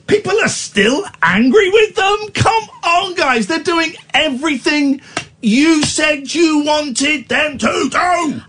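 exasperated rant. Real, not acted. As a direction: throw up your hands and plead with them. Indignant rant, voice up.

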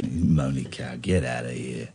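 low, soft, slurred heckle. Real, not acted. Caller insulting the co-host on air, slurred. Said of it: "you're sounding slurred".

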